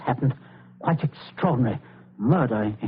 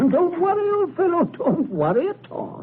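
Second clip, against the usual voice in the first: much higher, chuckling false cheer; laughing reassurance. Acted. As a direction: laugh it off too brightly.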